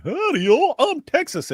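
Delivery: very high, squeaky cartoon sponge voice; high-pitched SpongeBob impression mocking a Texan.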